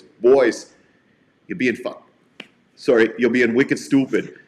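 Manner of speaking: put-on mild Boston accent, a big guy breaking up a fight; a stern Boston biker telling the boys off; a hint of Boston accent, firm and scolding. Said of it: "it's Boston, right?".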